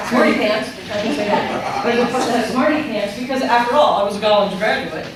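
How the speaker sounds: loud, high, laughing tease; self-mocking laugh. Real, not acted. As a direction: poke fun at the smarty-pants graduate. Mocking own younger self.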